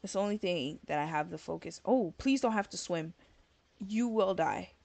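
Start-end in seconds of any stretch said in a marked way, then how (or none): none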